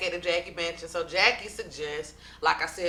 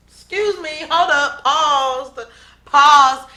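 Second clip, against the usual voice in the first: outraged interruption; 7 semitones higher, 12 dB louder, slower than usual. Real, not acted. Outraged reaction to O G's claim.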